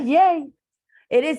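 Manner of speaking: loud, high-pitched yay; excited cheer. Real, not acted. Teacher to the class after they answer three, cheering.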